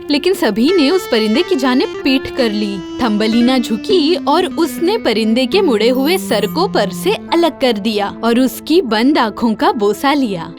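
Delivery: plain reading voice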